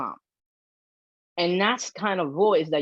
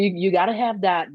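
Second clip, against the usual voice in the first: ordinary talk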